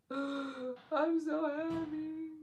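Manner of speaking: high, very slow squeal of joy; drawn-out happy squeal after a gasp; overjoyed. Retold or imitated. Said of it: "I'm like".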